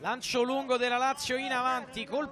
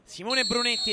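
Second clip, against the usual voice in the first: normal talking voice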